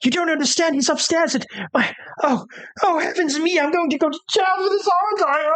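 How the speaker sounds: high, panicked servant voice; a shaken valet babbling in distress; high-pitched distraught manservant; frantic valet. Acted.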